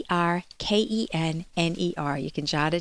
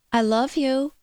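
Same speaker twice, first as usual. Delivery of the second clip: pleading, a bit higher and louder; begging; 5 semitones higher, 4 dB louder than usual. Retold or imitated. Host imitates a needy partner begging for love. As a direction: plead for reassurance. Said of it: "or they say it beggingly".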